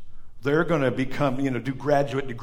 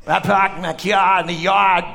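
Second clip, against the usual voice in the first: slow, hammed-up Boston accent; 3 semitones higher, 5 dB louder than usual. Bostonian telling where he parked, broad Boston accent. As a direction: drop the R's like a thick Bostonian.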